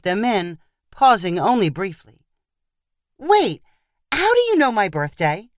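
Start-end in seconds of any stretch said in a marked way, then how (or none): 3.2-5.5 higher-pitched, alarmed demand. A narrator's reading. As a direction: stop him short and demand an answer. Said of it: "Rachel demanded".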